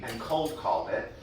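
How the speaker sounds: ordinary talk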